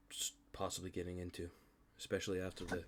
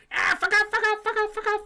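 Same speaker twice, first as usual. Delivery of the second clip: shrill squeaky creature yelling, much higher and louder; yapping creature; 13 dB louder than usual. Retold or imitated. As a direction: screech it like a tiny angry gnome-dog. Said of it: "they just start yapping at them and go".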